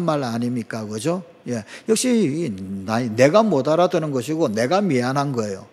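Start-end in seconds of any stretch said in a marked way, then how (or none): none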